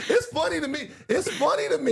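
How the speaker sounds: high-pitched laughing protest; amused and squeaky with laughter. Real, not acted. Cracking up after own impression.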